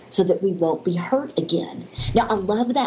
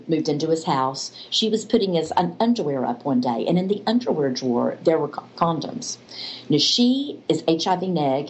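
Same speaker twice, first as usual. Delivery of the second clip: usual speaking voice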